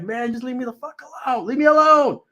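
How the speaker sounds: high, loud leave me alone, rising to a shout; desperate yell at the chasing wife; pleading yell. Retold or imitated. Wife chasing him around house.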